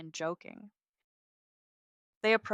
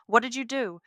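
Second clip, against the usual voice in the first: higher and much louder, raw; raw upset; 6 semitones higher, 10 dB louder than usual. Acted. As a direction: cry out in desperate distress. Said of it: "his voice raw".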